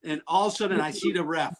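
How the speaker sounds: usual speaking voice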